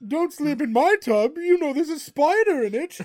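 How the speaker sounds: loud, high-pitched put-on character voice; over-the-top sitcom alarm; hammed-up warning. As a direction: cry out a mock warning. Joking quote of a sitcom character.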